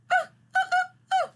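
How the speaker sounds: high squeaky puppet yelps; puppet squeaks. Pretend. Puppet Brownie squeaks an answer to the host. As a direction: chatter in a tiny high puppet voice.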